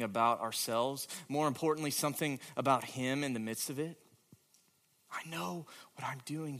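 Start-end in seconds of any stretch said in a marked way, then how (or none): 5.1-6.6 much softer, calm promise; Jesus gently promising he knows best; soft reassurance. Acted. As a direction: say it quietly, as a comfort. Jesus quoted softly.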